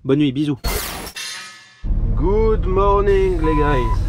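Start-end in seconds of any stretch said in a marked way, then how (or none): 2.1-4.0 cheery greeting. Real, not acted. Cheerful morning greeting to viewers.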